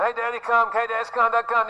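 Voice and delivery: put-on high little-kid voice tugging at dad; small child pestering. Kid pestering father reading the newspaper, high and pleading. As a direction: whine it up high like a kid wanting attention.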